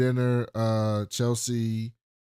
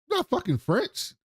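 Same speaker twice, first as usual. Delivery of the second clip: mock disbelief; 14 semitones higher than usual. Retold incredulous reaction in argument.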